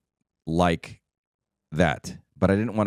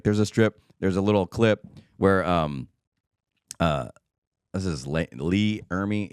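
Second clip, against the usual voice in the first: ordinary talk, nothing marked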